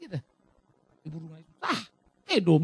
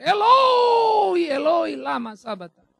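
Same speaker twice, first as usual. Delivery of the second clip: high, loud anguished shout; crying out; 8 semitones higher, 5 dB louder, much slower than usual. Acted. Jesus on the cross crying out to God, loud shout. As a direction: cry out to the Father. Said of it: "Lalu dia berteriak".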